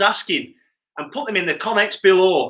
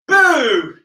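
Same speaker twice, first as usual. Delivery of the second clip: shout boo; 6 semitones higher, 5 dB louder than usual. Pretend. Jump out boo.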